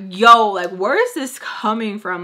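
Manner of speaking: loud indignant burst; loud outburst. Retold or imitated. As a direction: blurt it out loudly. Outburst at teacher.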